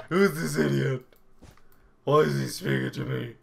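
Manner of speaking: mock annoyed player. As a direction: imitate someone outraged at being spoken to. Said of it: "It's like".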